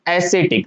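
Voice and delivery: एस्थेटिक said "e-SE-tik", with an s and no k; correct soft C pronunciation. Shown as a demonstration. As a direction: say एस्थेटिक with a soft s sound in the middle. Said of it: "तो इसका correct pronunciation होगा". (एस्थेटिक को "ए-से-टिक" बोलें)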